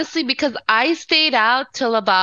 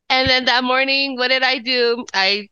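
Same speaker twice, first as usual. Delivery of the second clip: ordinary delivery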